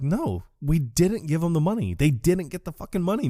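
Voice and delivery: lightly acted frantic insistence; mock distress. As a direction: imitate someone pleading in mild panic. Dude argues over ransom money.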